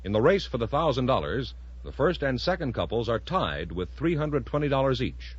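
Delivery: normal talking voice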